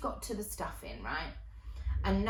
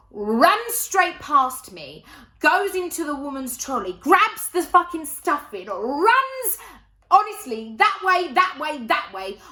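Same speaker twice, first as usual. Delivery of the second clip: loud, high-pitched breakneck-chase excitement; frantic storytelling; 6 semitones higher, 11 dB louder than usual. Retold or imitated. Telling how Nan grabbed the stuffing and fled, frantic shouting. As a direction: belt out each action at the climax. Climax of nan's theft and escape.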